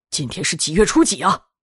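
tense, anxious asking; nervous question. A narrator's reading. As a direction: ask with a touch of alarm. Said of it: "吴老爷紧张地问着".

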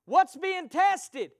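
ordinary talk, nothing marked

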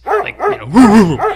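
high, loud mock woofing; dog bark imitation. Telling friends about the dog, mock barking. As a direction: yip like the little dog barking.

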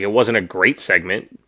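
normal talking voice

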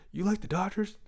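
high squeaky surprise; surprised kid. Retold or imitated. Boy reacting to Dodgers answer, surprised. Kid's excited question voiced.

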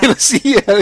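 high, loud bad Mexican accent; exaggerated Mexican accent; shitty Mexican accent. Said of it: "doing the shitty Mexican accent".

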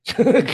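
high-pitched burst of laughter. Real, not acted. Laugh after quote.

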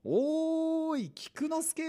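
long, high, drawn-out call across a distance; old man calling out. Retold or imitated. Old man waving and calling to the dog from afar, a long high call. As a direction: call the dog's name from far away like the old man. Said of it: "っていうおじいちゃんがいて".

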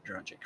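Dragic softly as DRAH-jick; habitual pronunciation. Shown as a demonstration. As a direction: say Dragic with a j in the middle and k at the end. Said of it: "I have always said Dragic".

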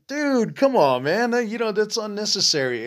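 exasperated plea. Retold or imitated. Retold pleading with trigger-happy partner.

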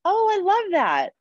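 high, slow, delighted gush; real delight at a new trick. Real, not acted. Podcast host loves the cohost's pizza breathing trick, in a high, slow gush.

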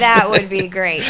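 slow, laughing repeat. Real, not acted. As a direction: let the laugh carry the words. Still laughing at the idea.

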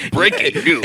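mock news anchor. Friend joining the bit, news anchor voice. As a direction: bark it like a TV headline. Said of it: "we have to talk like this the whole time".

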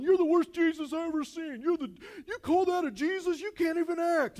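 heckler jeering. Retold or imitated. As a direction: taunt the actor from the crowd. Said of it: "this heckler guy starts going".

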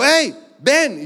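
put-on a grandfather calls the boy over in anger; angry shout. Grandfather calls boy away from the kids, angry shout. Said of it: "se empezó a enojar y empezó a correr".

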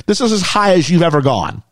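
normal talking voice

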